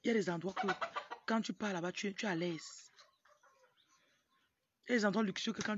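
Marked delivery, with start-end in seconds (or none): none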